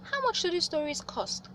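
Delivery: high, eager spider voice; Anansi asking. A narrator's reading. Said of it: "asked Anansi".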